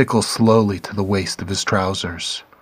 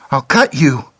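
higher, louder frightened threat; shrill threat; 5 semitones higher, 6 dB louder than usual. A narrator's reading. The bleeding girl threatening Clark, shrill and loud. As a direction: lash out in a high panicked voice. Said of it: "she said shrilly".

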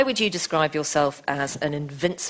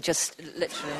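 normal talking voice

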